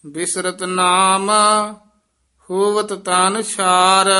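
measured, half-chanted verse reading; chanted Gurbani reading; scripture recitation. Acted. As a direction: recite sacred verse with long held vowels.

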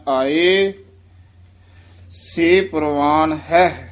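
ਆਏ lifted, long pause, then slow ਸੇ ਪਰਵਾਣ ਹੈ; pause after ਆਏ. Shown as a demonstration. Teacher demonstrates the pause after ਆਏ, slow. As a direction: stop on ਆਏ, then go on slowly. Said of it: "ਆਏ ਤੇ ਯਮਕ ਲਾ ਦੇਣੀ ਹੈ".

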